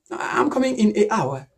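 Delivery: "an" replaced by "ay" before "hour"; wrong article demo. Shown as a demonstration. Student repeating the mistake to Peter, "an" said as "ay". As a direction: say "ay hour" where "an hour" is written, no N.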